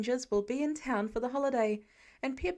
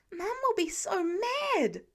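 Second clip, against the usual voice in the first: slow, much higher, stress on will; alarmed warning; 9 semitones higher, slower than usual. A narrator's reading. Peter warning Tony about Pepper, high and slow. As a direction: warn him, dragging out will.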